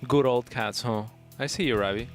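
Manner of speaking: usual speaking voice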